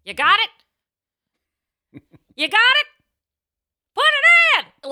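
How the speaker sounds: high, loud shouted whisper lines; shouting. Retold or imitated. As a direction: belt the sexy line like a yell. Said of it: "And I'd be like".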